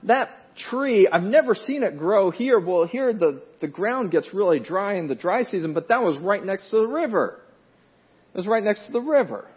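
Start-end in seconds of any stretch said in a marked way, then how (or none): 0.0-7.4 higher, louder, excited realization; dawning realization. Retold or imitated. Farmer realizing why the tree fails, excited and loud. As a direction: voice the farmer's sudden eureka. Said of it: "he starts realizing".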